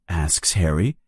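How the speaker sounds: usual speaking voice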